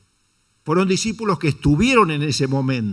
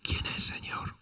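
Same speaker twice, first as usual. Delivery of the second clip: whisper; 7 dB quieter, slower than usual. Acted. Juan asks Jesus secretly.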